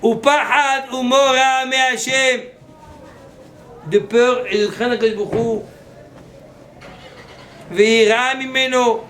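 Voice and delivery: loud, high preaching at full force. Acted. Rabbi preaching fear of God, loud and fervent. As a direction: thunder out fear and refuge.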